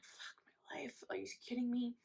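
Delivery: whispered disbelief under the breath; exasperated whisper. Retold or imitated. Reacting to son spilling the secret, exasperated whisper. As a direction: hiss it quietly to yourself in mock despair.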